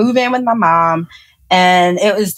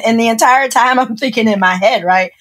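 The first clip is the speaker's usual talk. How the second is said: ordinary talk, nothing marked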